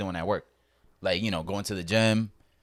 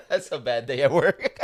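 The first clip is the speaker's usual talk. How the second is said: laughing; 3 semitones higher than usual. Real, not acted. Laughs through grim story reaction.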